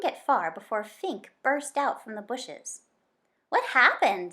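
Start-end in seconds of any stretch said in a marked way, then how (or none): 3.5-4.3 panting. A narrator's reading. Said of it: "the fox panted".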